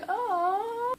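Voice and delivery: high-pitched cooing aw. Retold or imitated. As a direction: melt over something cute. Cooing at cute moment in book.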